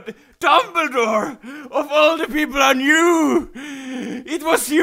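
loud, high melodramatic shout; mock betrayed cry. Stabbed man accusing Dumbledore, hammed-up betrayed shout. Acting a betrayed Harry Potter scene.

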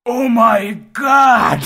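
slow, high, loud cry of joy; excited shout. Real, not acted. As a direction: burst out in delighted disbelief. Hears about new Daredevil show.

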